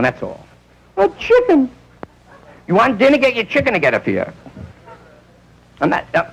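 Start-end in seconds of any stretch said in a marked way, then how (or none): 1.0-1.7 high outraged wife; shrieking wife. Retold or imitated. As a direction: spit it out in disgust. Wife finds husband with chicken.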